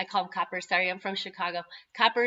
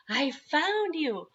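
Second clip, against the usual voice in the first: put-on child's voice; 6 semitones higher, much slower than usual. Son proudly returns, voiced by mom.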